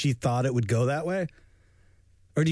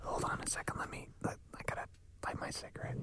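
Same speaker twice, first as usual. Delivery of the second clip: whispered shady seller; 5 semitones higher, 10 dB quieter than usual. Pretend. Role-play gas thief whispering.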